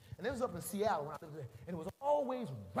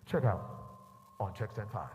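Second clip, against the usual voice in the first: page the bagger in a low, measured boom; low-pitched tannoy summons; store PA call; 10 semitones lower than usual. Retold or imitated. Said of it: "I hear it again".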